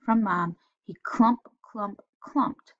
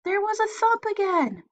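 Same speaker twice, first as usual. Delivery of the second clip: put-on scared boy; 13 semitones higher than usual. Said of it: "he yelled".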